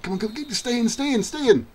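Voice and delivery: high, rapid urging; will the ball on in a high voice; urgent pleading. Real, not acted. Urging his kick to stay in.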